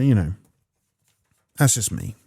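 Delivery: ordinary delivery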